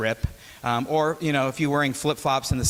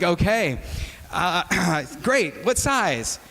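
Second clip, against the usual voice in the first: higher, eager and a bit awkward; chipper barista; 4 semitones higher, much slower than usual. Retold or imitated. Barista pushes on with the order, forced cheerful and high. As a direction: keep the forced cheer going. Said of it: "I'm like".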